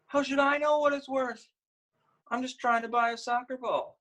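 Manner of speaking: put-on girl defending her price; exasperated little girl. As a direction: protest like a frustrated child.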